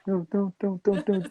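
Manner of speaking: high, strained pleading don't; urgent warning. Retold or imitated. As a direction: warn him off, high and tense. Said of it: "he's almost like".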